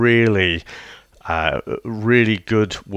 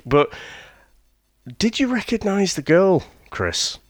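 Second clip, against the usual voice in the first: ordinary delivery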